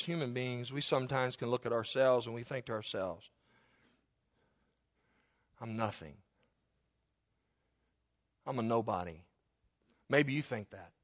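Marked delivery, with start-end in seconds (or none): 5.6-9.2 low, soft, voiced inner thought; downcast self-doubt. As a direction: say it small, as someone belittling themselves.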